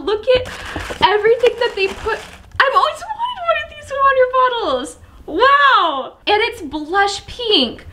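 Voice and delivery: high-pitched gushing delight. Real, not acted. Vlogger finding a long-wanted water bottle, delighted. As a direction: gush high over a dream gift.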